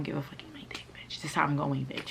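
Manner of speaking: fast, hissed whisper. Retold or imitated. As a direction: lean in and whisper the insult. Whispered aside in the rant.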